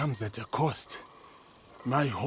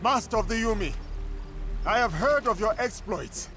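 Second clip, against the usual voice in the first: raised, higher voice calling out across the field; loud challenge; 11 semitones higher, 3 dB louder than usual. Acted. Student calls out to the bow master, loud challenge. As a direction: announce yourself boldly, projecting loud and high.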